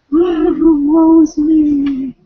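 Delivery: very slow, high, loud mock despair; mock lament. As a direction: wail it like a tragic heroine. Moaning about fifty-one chapters left.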